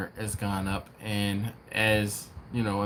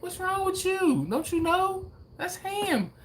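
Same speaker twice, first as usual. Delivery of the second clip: high-pitched mock waitress; nonchalant waitress. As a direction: imitate her obliviousness in a much higher voice. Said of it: "it was so nonchalant".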